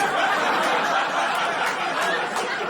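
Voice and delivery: breathy real laugh. Real, not acted. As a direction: let a laugh out. Laughing at own joke.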